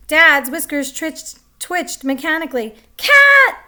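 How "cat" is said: high, loud scream "Cat!". A narrator's reading. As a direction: scream in terror. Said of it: "Helen and Martha cried".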